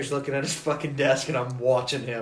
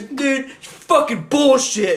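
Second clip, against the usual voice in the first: higher, slow mock outrage; indignant protest; 8 semitones higher, 4 dB louder, much slower than usual. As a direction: drag it out in disbelief. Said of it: "And he goes".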